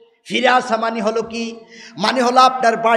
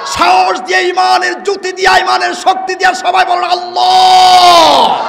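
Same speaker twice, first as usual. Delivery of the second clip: high, full-force build to a long-held cry; loud rallying shout; 8 semitones higher, 7 dB louder than usual. Shown as a demonstration. Preacher leads everyone calling Allah, prolonged shout. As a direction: shout it with courage, stretching the last word. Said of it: "আর জোর বলেন".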